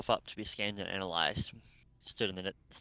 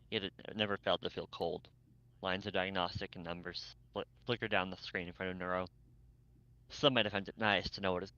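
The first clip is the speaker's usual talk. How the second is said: usual speaking voice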